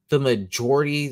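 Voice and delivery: ordinary talk, nothing marked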